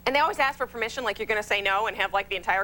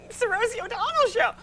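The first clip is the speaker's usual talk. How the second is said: high-pitched mock show plug; mock announcement; 10 semitones higher than usual. Comedian joking about promoting her talk show, mock announcement. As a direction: pitch it way up, tongue in cheek.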